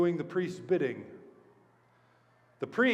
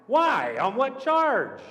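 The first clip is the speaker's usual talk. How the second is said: high, drawn-out disbelieving questions; incredulous; 5 semitones higher, 4 dB louder, slower than usual. Acted. Pilate questions the crowd's demand, incredulous. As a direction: challenge them in disbelief, stretching the words. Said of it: "Pilate couldn't believe his ears".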